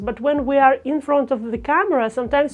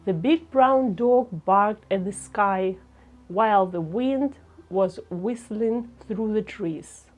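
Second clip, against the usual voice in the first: slow, measured, lower delivery; slow pace; 3 semitones lower, 4 dB quieter than usual. Shown as a demonstration. Teacher has learners start with talking slowly, slow measured pace. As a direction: take it slowly, low and softening at the end. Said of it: "starting with talking slowly".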